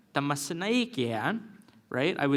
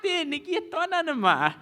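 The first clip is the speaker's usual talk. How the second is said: high, exasperated mock plea; own past self; 13 semitones higher than usual. Learner pleading with the elder, high mock exasperation. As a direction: squeak it out in mock despair. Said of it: "I'm like".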